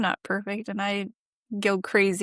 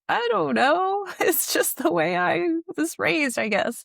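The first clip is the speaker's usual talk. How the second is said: laughing, sheepish; 9 semitones higher, 3 dB louder than usual. Retold or imitated. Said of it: "And I'm like".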